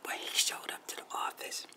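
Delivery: unvoiced whisper, secretive build-up. Real, not acted. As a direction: whisper it like a suspense teaser. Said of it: "whispered story teaser".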